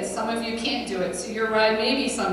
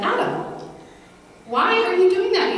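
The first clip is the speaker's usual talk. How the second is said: much higher, louder, surprised reproach; gently scolding teacher; 10 semitones higher, 3 dB louder than usual. Retold or imitated. Daycare teacher stopping Adam eating during grace, high surprised call. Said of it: "And she said".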